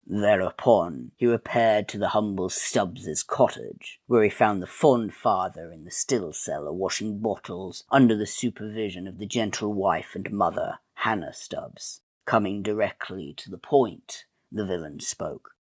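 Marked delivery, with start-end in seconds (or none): none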